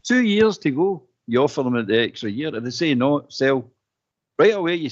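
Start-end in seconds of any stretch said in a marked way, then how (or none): 0.0-1.0 heated insisting. Real, not acted. Heated radio debate, insisting.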